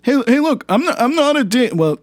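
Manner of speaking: ordinary delivery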